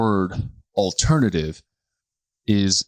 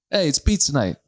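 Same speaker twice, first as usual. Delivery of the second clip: a hint of a hammed-up Italian accent; bad Italian accent; 6 semitones higher than usual. Sicilian dad joking about Italian pizza night, bad Italian accent. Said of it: "that's a bad Italian impress-, impression".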